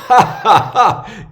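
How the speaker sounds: loud, high mock guffaw; imitated group laughter. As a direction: imitate the officers bursting out laughing.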